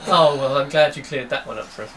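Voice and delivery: ordinary talk, nothing marked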